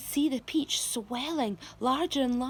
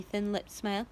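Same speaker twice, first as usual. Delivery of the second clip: plain reading voice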